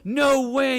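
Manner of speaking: loud, high burst of disbelief; surprised shout. Real, not acted. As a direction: blurt it out in shock. Host reacts to retired penguin.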